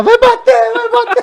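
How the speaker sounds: very high, loud falsetto-like yell in jest; mock desperate scream. Listener parodies the desperate friend for laughs, mock screaming. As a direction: shriek it in comic panic. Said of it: "Tá todo mundo lá desesperado".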